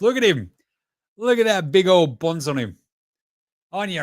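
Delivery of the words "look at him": ordinary talk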